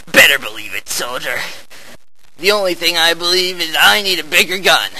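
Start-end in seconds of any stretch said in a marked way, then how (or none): 0.1-1.4 gruff drill sergeant. Acted. Gruff answer to own line.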